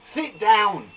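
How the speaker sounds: firm, loud order; command. Shown as a demonstration. Teacher showing pupils a command, ordering loudly. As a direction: order them into their seats. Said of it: "These are all commands".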